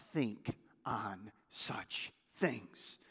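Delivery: slow whisper of the closing verse; whispered scripture. Acted. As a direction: breathe the last words of scripture. Scripture reading ends in whisper.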